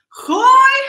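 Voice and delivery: loud, high shout; shouted cue. Real, not acted. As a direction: yell the move.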